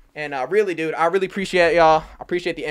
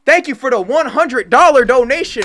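excited; 4 semitones higher, 5 dB louder than usual. Real, not acted. Hundred dollar donation arrives.

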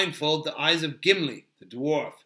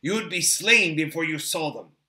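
firm, lightly raised warning; understated elven sternness; stern Haldir; 4 semitones higher, 7 dB louder than usual. A narrator's reading. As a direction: lay down the law with authority. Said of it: "said Haldir sternly".

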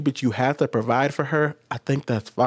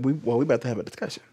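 softer, fast, pointed warning to the wife; mock stern warning; 6 dB quieter, faster than usual. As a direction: tell her quickly and quietly there's trouble ahead. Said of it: "I'd be like".

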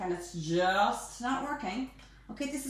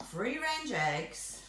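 normal talking voice

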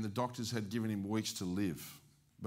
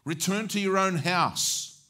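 commanding voice of Jesus; 7 semitones higher, 8 dB louder, slower than usual. Acted. Preacher voicing Jesus' command.

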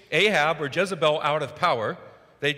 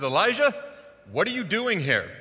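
raised, higher voice calling a name; 7 semitones higher, 4 dB louder than usual. Retold or imitated. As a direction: call across to him, louder and higher. God calls out to Elijah.

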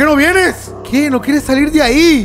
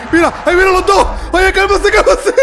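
panicked shout at the monsters; startled shouting; 6 semitones higher than usual. Real, not acted. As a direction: yell at the charging monsters to settle. Two monsters chasing him.

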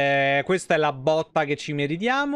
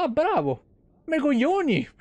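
higher, deadpan sarcastic well-done; mock sarcastic praise; 8 semitones higher than usual. Merchant mocked for faint praise of the dragon kill, pitched up and sarcastic. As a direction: fake applause for the dragon slayer. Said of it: "Quello ti fa".

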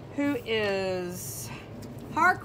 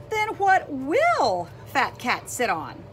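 ordinary talk

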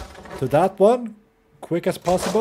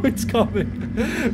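ordinary delivery

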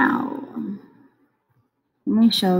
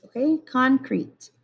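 normal talking voice

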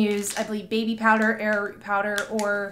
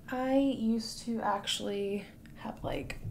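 whispering; 9 dB quieter, slower than usual. Real, not acted. Aside while holding wax.

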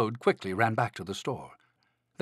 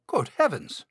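astonished exclamation, pitched up; gasping storybook astonishment; startled Frog; 6 semitones higher than usual. A narrator's reading. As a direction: exclaim it in surprise.

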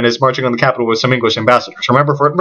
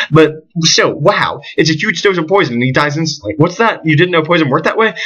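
ordinary delivery

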